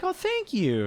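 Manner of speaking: high, soft, half-hearted thank you; high-pitched, mildly strained politeness. Retold or imitated. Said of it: "a little less enthusiastically".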